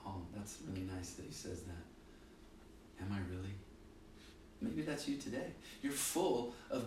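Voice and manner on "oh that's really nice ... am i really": quiet, sheepish and embarrassed. Retold or imitated. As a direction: shyly brush off the compliment. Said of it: "they may sheepishly go".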